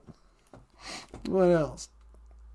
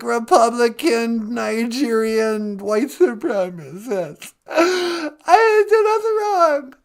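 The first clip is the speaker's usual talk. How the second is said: hammed-up sobbing rant; 4 dB louder than usual. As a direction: rant through fake tears. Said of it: "quit crying".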